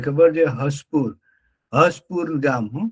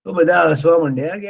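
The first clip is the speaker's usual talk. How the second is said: usual speaking voice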